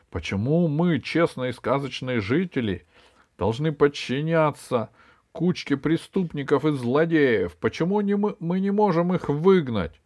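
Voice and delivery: rising rhetorical protest; rally the crowd with indignant questions; indignant appeal. Acted. Дед Мороз rallies honest residents, indignant rising appeal.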